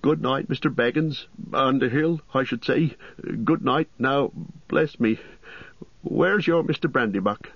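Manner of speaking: high, forgetful landlord correcting himself; flustered innkeeper. A narrator's reading. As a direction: fumble the good night as an innkeeper.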